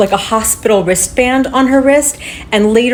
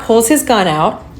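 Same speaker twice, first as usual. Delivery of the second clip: usual speaking voice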